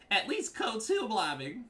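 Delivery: high, drawn-out mocking voice; mock whiny opponent. Player reading rival's complaint aloud, high mock whine. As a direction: mimic the complainer, high and slow.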